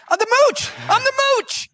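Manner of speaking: very high, loud squeaky boast; mock Scaramucci. Guest boasting his nickname, hammed-up squeaky voice. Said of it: "He's like".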